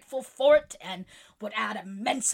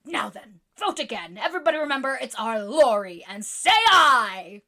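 building to a loud rallying call; rally the votes, louder and louder; excitedly; 4 semitones higher, 8 dB louder than usual. Acted. Jo urging the club to vote aye, excited. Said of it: "cried Snodgrass excitedly".

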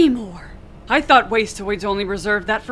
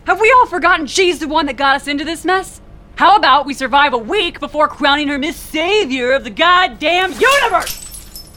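angry; 6 semitones higher, 4 dB louder than usual. Acted. Captain angrily scolding crew.

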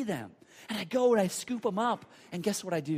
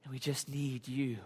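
low, soft, hushed prayer; closing prayer; 10 semitones lower, 11 dB quieter than usual. Real, not acted. Pastor leading the congregation in closing prayer, low and reverent. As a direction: pray quietly with long pauses.

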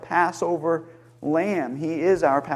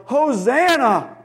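long, higher-pitched mock crowd yell; imitated yell; 8 semitones higher, slower than usual. Preacher voicing crowds at Jesus entering Jerusalem, imitated yell. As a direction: draw it out like a restrained cheer. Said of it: "they yelled".

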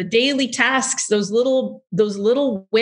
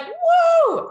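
excited whoa. Retold or imitated. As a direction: gasp out a thrilled whoa. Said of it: "you're like".

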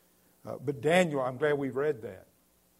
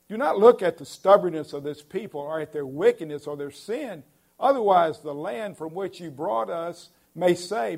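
high, loud pleading prayer read; fervent preaching; 4 semitones higher, 8 dB louder than usual. Acted. Preacher voicing Moses begging God for his people, loud pleading. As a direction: cry out Moses' plea to God with full force.

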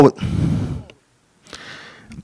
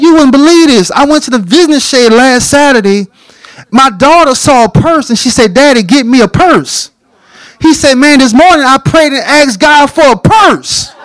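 much higher, breathless amazement; excited retelling; 12 semitones higher, 3 dB louder than usual. Retold or imitated. Crying pastor telling George through the fence, excited and high. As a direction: burst out the story in wonder.

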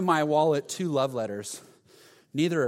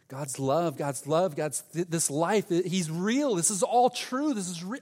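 retold gush of amazement, rising higher and higher; exploding with passion; 4 semitones higher than usual. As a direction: build breathlessly, imitating an overwhelmed believer. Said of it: "he's just exploding with passion".

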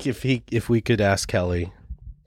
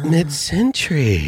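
drawn-out, higher-pitched dreamy gushing; mock swooning; 6 semitones higher, slower than usual. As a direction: imitate someone swooning over decor, slowly. Mimics women swooning over the style.

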